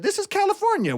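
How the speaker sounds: high, mocking local voice; quoted local, voiced high; locals' voice. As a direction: pitch it way up as a local. Locals answering in quoted dialogue.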